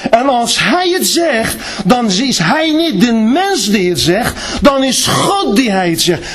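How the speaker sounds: high, ringing proclamation building to a cry; preaching at full force. Acted. Preacher promising the church God never leaves, full force. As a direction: preach it out, rising to a shout.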